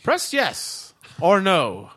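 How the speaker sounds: slow automated phone prompt; phone menu voice. Acted. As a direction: spell out the options like a phone menu. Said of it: "Say yes or press one to continue".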